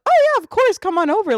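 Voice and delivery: high, loud imitation of an eager host; gushing mock invitation; excited welcome. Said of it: "she literally was excited to have us there".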